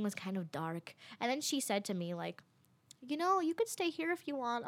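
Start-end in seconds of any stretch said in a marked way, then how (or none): 3.1-4.7 imitated offer. Voicing the lady's offer.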